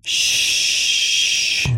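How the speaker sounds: long, drawn-out "shh"; hushing shush. Shown as a demonstration. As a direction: shush them as if finger to lips. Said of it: "put your finger to your mouth and went".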